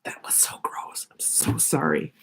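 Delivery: hushed, unvoiced apology; whispering. Real, not acted. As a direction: drop to a whisper. Apology after her nose ran.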